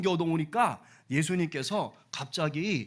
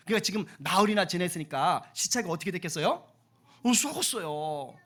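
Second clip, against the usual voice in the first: usual speaking voice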